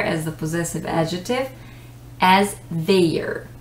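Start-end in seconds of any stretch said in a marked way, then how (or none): 2.7-3.5 THEIR split into "they-er", sounding the I; mispronounced their. Shown as a demonstration. Teacher showing students' common mistake, mispronounced their. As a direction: say "their" wrongly as two vowels, THAY-er. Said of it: "people would read it as their".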